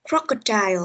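Crocodile with CROC punched, then falling; first-syllable stress. Shown as a demonstration. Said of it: "Từ này nó sẽ nhấn âm đầu tiên". (Crocodile nhấn mạnh âm đầu tiên)

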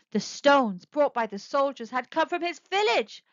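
ordinary delivery, nothing marked

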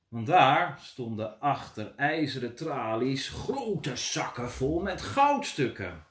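ordinary delivery

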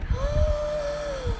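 high, drawn-out soft gasp; long airy gasp of admiration; long swooning gasp. Real, not acted. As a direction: suck in a long, admiring breath. Swooning over handsome workers.

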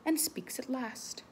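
suspenseful whisper; a whispered build-up. Acted. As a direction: hush the kids before the reveal. Hushed suspense.

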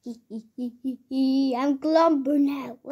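slow, drawn-out grumpy pretend voice. Child pretending to be Clumper, in a slow grumpy voice. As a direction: stretch it out like a grumpy character. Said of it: "Why do you have that grumpy voice".

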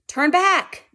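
high, loud plea to turn back; begging shout. Acted. Frightened sailors begging the captain, high pleading shout. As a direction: cry out to turn the ship around. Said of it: "they begged".

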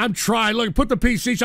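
usual speaking voice

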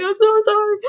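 very high, plaintive retold apology; mock tearful apology. Crying mother apologises to the driver from the backseat, mock tearful. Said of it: "And then I started crying".

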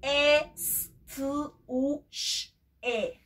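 "E, s, t" segmented; letter by letter; sounded out. Shown as a demonstration. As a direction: spell the sounds. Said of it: "vayan a buscar el suyo".

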